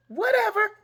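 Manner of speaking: high, loud brush-off; dismissive whatever. Retold or imitated. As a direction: wave it off like Nan Nan. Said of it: "whatever was her word".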